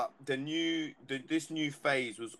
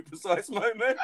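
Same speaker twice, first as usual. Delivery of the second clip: laughing through the words; 7 semitones higher, 4 dB louder than usual. Real, not acted. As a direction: giggle out the words. Laughing at own story.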